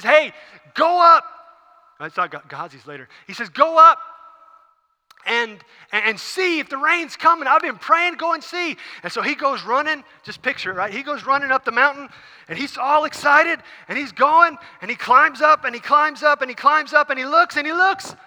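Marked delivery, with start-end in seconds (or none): 0.0-1.2 loud, high shouted order; acted urgent holler; calling out. Elijah calls out to servant. 3.5-8.8 high, loud acted order; excited calling out; urgent command. Elijah urgently sends servant to look. 10.9-18.1 high, loud, mounting narration; worked-up preacher retelling; excited storytelling. A narrator's reading. Acts out servant's excited climb.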